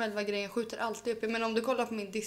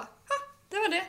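much higher, smug victorious exclamation; triumphant retort; 11 semitones higher than usual. Retold or imitated. Road worker done yelling at taxi drivers, smug high triumph. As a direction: toss it off like a victory.

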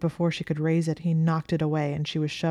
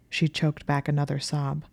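usual speaking voice

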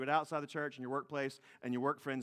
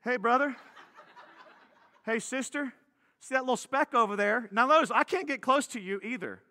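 a touch of a cheery busybody, louder; mock self-righteous; 5 semitones higher, 5 dB louder than usual. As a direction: point at the speck with a log in your eye. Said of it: "you're gonna remember this too".

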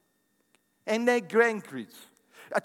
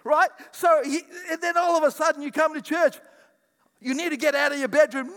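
louder, higher preaching push with a laugh in it; preaching at full force; 7 semitones higher, 5 dB louder than usual. Acted. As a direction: drive the point home to the congregation.

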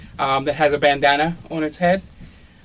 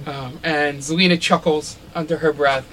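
ordinary delivery

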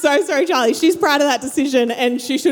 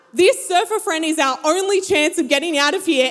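ordinary delivery

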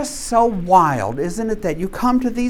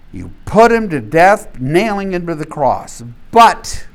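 loud, ringing apostle's appeal to the crowd; preaching as Peter; 6 dB louder than usual. Acted. Peter to the Jerusalem crowd, loud preaching. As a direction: proclaim it like Peter rising before the crowd.

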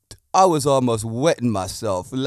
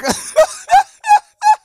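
very high, loud cackle of laughter; shrieking laugh; 9 dB louder than usual. Real, not acted. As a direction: shriek with laughter at your own joke. Laughing at Padlock joke.

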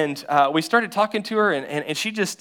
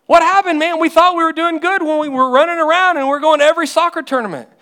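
loud high distraught parents crying out; loud, high lament of worried parents; desperate parents wailing; 10 semitones higher, 7 dB louder than usual. Retold or imitated. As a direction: wail it like despairing parents. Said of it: "and they're wondering".

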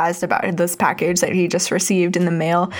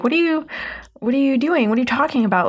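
mildly imitated disbelief, pitched up; a touch of baffled protest in a higher voice; incredulous; 5 semitones higher, faster than usual. Twister players questioning Winslow about the hypnosis, incredulous. Others challenge Winslow's mockery.

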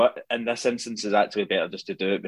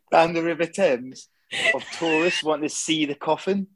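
high, measured London English accent; English accent impression; 6 semitones higher than usual. Shown as a demonstration. Continuing the queue for the Queen's coffin, English accent. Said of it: "try and say it in English".

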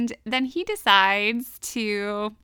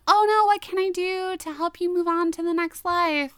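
high put-on girl's plea; mock worried girl; 8 semitones higher than usual. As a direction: plead earnestly with a ghost, playfully acted. Retold girl addressing supposed ghost.

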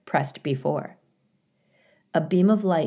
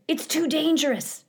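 alarmed flock; 10 semitones higher than usual. A narrator's reading. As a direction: warn them in a high goose voice. Flock warns its leader.

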